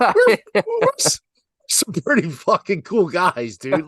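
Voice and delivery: high, loud, laughing excitement; mock excited strangers. Imagined lucky golfers on meeting the pair, high giddy excitement. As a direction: squeal it like thrilled strangers.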